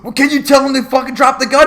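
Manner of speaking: hammed-up exasperated officer; mock angry cop. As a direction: snap back like an irritated cop. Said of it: "cop answering the kids".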